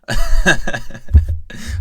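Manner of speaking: loud burst of laughter; real, breathy belly laugh. Real, not acted. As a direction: crack up loudly at your own silliness. Amused at his own picture remarks.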